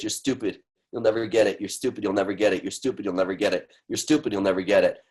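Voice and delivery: low, quiet sneering inner voice; inner critic voice. Shown as a demonstration. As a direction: voice the nasty inner critic under your breath. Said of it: "we gotta say that".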